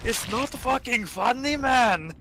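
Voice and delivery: high, indignant mock rage; angry. Teammate scolding laughing Screw, high mock anger. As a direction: play the offended angry friend. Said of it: "he got so mad at me".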